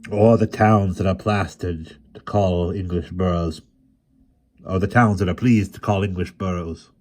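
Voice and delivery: loud, proud recital of titles; an old bard proudly proclaiming his prince. A narrator's reading. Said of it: "with chilly pride".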